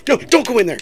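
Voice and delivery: high, loud panicked yell; panicked shout. Real, not acted. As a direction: shout a warning in alarm.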